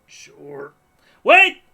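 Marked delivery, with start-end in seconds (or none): 1.2-1.6 sudden high, loud yell; jump scare shout. Real, not acted. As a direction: blurt it out to startle. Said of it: "Oh my god, you scared me".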